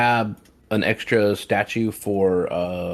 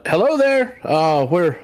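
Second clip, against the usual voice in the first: very high, hesitant, placating voice; nervous greeting; 13 semitones higher, 4 dB louder, slower than usual. Acted. Player talking to the giant to show peace, nervous. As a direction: squeak out a timid peace offering, louder than usual.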